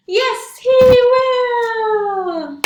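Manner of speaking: loud, high burst; spring up with a shout like the jack; big pop-up shout. Acted. Librarian shouting as the jack in the box springs out.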